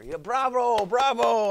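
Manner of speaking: loud, high bravo calls; big exuberant applause shout; cheering. Real, not acted. Cheering the end of the story.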